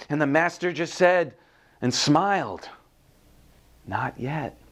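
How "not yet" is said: soft reply through a smile; calm refusal. A narrator's reading. Smiling master to the sickened teacup, soft calm refusal. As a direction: answer gently and quietly, unmoved.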